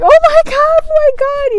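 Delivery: screaming in fear while driving away; terrified screaming. Retold or imitated. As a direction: scream in terror at the ghost. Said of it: "we're screaming".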